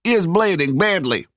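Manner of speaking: high-pitched alarmed soldier. A narrator's reading. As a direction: protest high as the worried soldier. Soldier protests to shouting commander.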